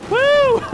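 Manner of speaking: high, loud "woo"; excited whoop. Real, not acted. Player cheers mid-game, excited whoop. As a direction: let out a big high-pitched cheer.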